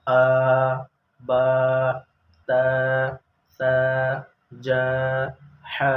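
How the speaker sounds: each syllable drawn out long and loud; drawn-out aa, baa, taa, saa; stretched vowels. Shown as a demonstration. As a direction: hold every vowel for a full beat. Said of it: "इसको मतन भी पढ़ना है".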